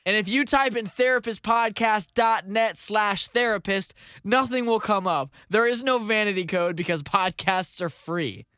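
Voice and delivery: mock ad read. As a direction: sell it like a cheesy radio ad.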